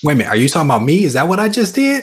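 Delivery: high, rapid-fire fake alarm; mock offended. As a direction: cut in as if you've been personally accused. Jokingly takes the criticism personally.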